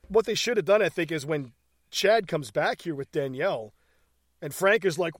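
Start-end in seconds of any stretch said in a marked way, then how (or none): none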